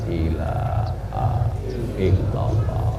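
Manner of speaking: faint, slow breath of the last words; dying whisper. Shown as a demonstration. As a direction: fade to a breathless whisper as life leaves. (bisikan lemah orang sekarat)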